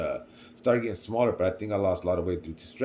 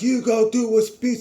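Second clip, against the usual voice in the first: much higher, louder mock urging; insisting hype; 12 semitones higher, 6 dB louder than usual. Teammates insisting Hugo give the speech, loud and urging. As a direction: push him to go speak.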